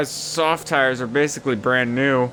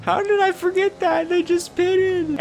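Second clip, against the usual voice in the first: mock high self-scolding. Real, not acted. Mocking own mistake.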